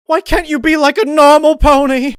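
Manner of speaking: very high and loud shriek; shrieking rage. Acted. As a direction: shriek at her in tearful fury. Rarity shrieking while stabbing, crying.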